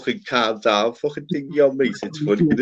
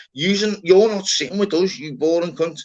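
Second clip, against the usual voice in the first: higher, cheeky jab in the lad's voice; mock insult; 7 semitones higher than usual. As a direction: rib him as the lad would.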